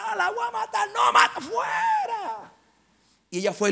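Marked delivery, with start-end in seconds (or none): 0.1-0.9 very high, strained demonic threat; put-on shrieking voice of a demon. Demon threatens through the possessed woman, high shrill threat. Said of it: "Y me decía". 0.9-2.5 very high, drawn-out yell; exorcism shout. Retold or imitated. Preacher casts the demon out, screamed command.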